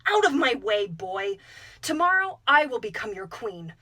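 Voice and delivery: loud, imperious yell; angry shout. A narrator's reading. Manyara shoving the hungry boy aside, furious shout. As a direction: bark him out of your way.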